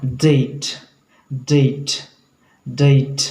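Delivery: डेट opened with a soft tongue-to-teeth D; slow डेट, soft onset and stretched vowel; soft English D. Shown as a demonstration. Teacher drills example word, soft D in डेट. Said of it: "तो एक soft sound है".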